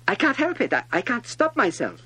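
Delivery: high, flustered protest; embarrassed. A narrator's reading. Little prince excusing himself to the king, flustered. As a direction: blurt out a helpless excuse. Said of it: "thoroughly embarrassed".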